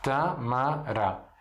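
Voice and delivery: "Tâmara" said very slowly; slow careful demo of tamara; Arabic tamara, drawn out. Shown as a demonstration. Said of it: "que é a tâmara".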